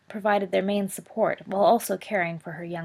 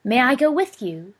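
giggling little brother; 6 semitones higher, 9 dB louder than usual. A narrator's reading. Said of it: "giggled Regulus".

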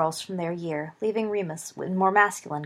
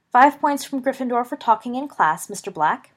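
higher, louder strict teacher voice; stern teacher; 5 semitones higher, 7 dB louder than usual. Acted. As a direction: dock points like a stern professor. Teacher scolds in class.

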